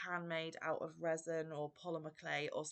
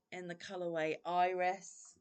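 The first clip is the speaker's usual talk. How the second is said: usual speaking voice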